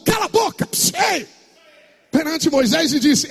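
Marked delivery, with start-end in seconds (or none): 0.1-1.3 high, sharp order to be silent; commanding shout. Acted. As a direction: shut the crowd up like Caleb. Caleb silencing the people.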